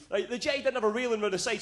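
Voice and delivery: normal talking voice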